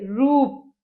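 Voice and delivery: रूप with the ऊ stretched long and slow; रूप said with a long, held ऊ; drawn-out रूप. Shown as a demonstration. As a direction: pull out the ऊ in रूप. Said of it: "खींच के बोला है रूप".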